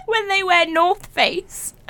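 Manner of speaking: slow, high, through giggles; laughing. Real, not acted. Laughing through next ick.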